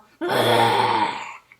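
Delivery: deep, loud mechanical toy roar; a giant electronic roar; toy dinosaur roar. A narrator's reading. Toy dinosaur roaring in the garden, loud mechanical roar.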